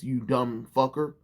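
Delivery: ordinary talk, nothing marked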